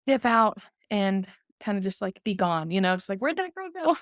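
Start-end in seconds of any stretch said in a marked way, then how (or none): 3.2-4.0 much higher, imitating a puzzled fan; light voicing of someone wondering where she went; mock puzzled listener. Host imagines fans wondering where she went, mock puzzled.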